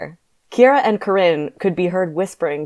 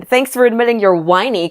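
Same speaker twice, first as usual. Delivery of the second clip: pitched-up, louder taunt; 6 semitones higher, 6 dB louder than usual. A narrator's reading. Corinne taunting Kyron, mocking and louder. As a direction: throw it back as a jeer. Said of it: "Corinne taunted".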